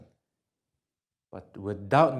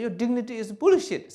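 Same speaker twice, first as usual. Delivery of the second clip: higher, louder mock jeer; mock insult; 10 semitones higher, 8 dB louder than usual. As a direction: hurl a lightly hammed-up insult. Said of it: "Somebody say".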